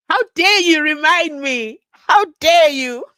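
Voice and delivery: high, loud play-indignant outburst; mock outrage. Host playfully scolds a viewer for the reminder, mock outrage. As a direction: cry out in hammed-up offence, stretching the last part.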